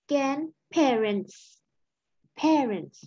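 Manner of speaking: "grandparents" and "parents" ending on a clear hissed s; final s sounded. Shown as a demonstration. Teacher corrects the missing s: stressed final s. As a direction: stretch the last s of "grandparents" and "parents". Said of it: "grandparents มีตัว S เนาะ".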